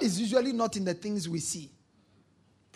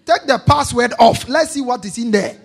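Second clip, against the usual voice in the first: raised-voice order, hammering each word; loud demand; 2 semitones higher, 9 dB louder than usual. Acted. Preacher voices a partner demanding the password off, loud demand. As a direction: insist loudly, as in a quarrel.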